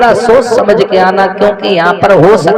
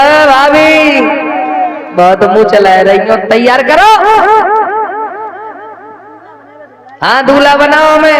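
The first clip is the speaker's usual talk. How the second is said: Shishupal demanding; 5 semitones higher than usual. Retold or imitated. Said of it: "Shishpal कहने लगा".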